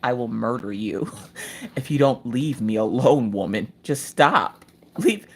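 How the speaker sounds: lightly voiced murderous husband; grumpy husband. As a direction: menace her low and tired. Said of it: "looking at me like".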